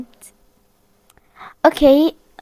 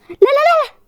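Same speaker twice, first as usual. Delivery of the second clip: very high screaming nos; shrieking no in panic; terrified no; 11 semitones higher than usual. Real, not acted. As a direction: cry out as he grabs you. Caught and killed by chef.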